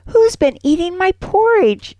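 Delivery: high, squeaky little bear voice; Baby Bear squeak. A narrator's reading. Tiny Baby Bear finds his bowl empty, squeaking. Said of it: "he squeaks".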